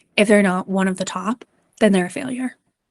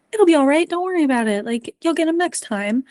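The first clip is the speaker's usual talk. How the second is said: brightly raised, breezy brush-off; dismissive reassurance; 6 semitones higher than usual. Shown as a demonstration. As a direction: cheer them up too fast. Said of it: "So instead of, like".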